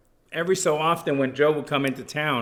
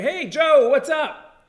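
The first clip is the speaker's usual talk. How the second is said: much higher, louder mock greeting; cheerful call; 13 semitones higher, 6 dB louder, slower than usual. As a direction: sing out a bright hello across the field. A-Rod greets Joe in practice.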